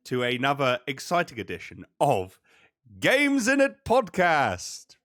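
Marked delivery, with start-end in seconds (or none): 3.0-5.0 slow, drawn-out show intro; announcer. Acted. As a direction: announce it like a host.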